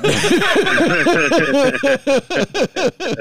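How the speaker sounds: high, loud burst of laughter; real belly laugh; big laugh. Real, not acted. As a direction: laugh out hard at the joke. Reacting to joke.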